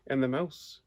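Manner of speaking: ordinary delivery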